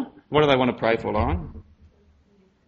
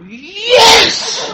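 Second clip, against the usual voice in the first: much higher, louder joyful cry of yes; excited yes; 7 dB louder than usual. Retold or imitated. Little kid showing how he'd greet Jesus, thrilled cry. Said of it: "like this".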